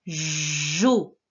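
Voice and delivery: JO with a long buzzing J; sounded-out syllable. Shown as a demonstration. Teacher correcting the dictation, stretched-out sounds. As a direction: hold both sounds of JO slowly. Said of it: "c'était la syllabe".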